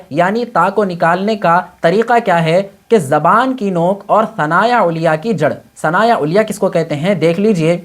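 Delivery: ordinary delivery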